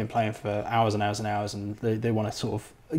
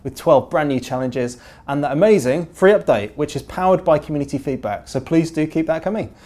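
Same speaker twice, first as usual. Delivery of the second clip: bright, upbeat show-host opening, a touch higher; podcast host intro; 5 semitones higher, 7 dB louder than usual. Acted. Host opening the podcast to its listeners, upbeat presenter read. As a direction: welcome listeners like a radio presenter.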